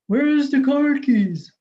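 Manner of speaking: impatient dad calling for keys; dad asking. Retold or imitated.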